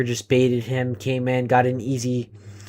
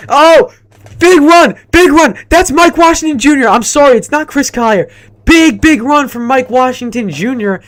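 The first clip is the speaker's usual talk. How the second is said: much higher, louder hyped commentary; excited play-by-play; 9 semitones higher, 10 dB louder than usual. Real, not acted. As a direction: scream the big run call. Live play-by-play call.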